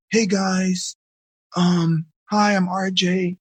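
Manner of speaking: timid, hesitant hello with eyes down; mumbled awkward self-introduction; shy greeting. Shown as a demonstration. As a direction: act too shy to look anyone in the eye. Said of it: "if I did this and I was talking to you guys like this".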